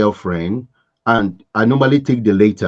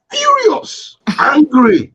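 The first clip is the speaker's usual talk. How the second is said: emphatic; 4 dB louder, much slower than usual. Real, not acted. High emphatic.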